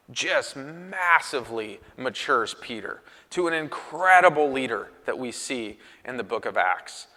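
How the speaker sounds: normal talking voice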